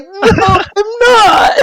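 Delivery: high, loud, drawn-out wail; hammed-up crying kid; mock bawling. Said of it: "And then I start bawling".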